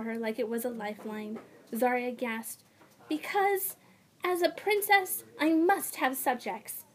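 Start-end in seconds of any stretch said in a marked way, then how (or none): 3.1-6.8 put-on high, proud young girl; princess voice. A narrator's reading. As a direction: lift the voice into a haughty princess. Zarya voiced in story.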